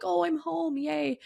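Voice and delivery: high, lilting little cheer; a sing-song, playful yay; mock glee at being home. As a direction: squeal it like a happy kid home at last. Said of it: "I'm like".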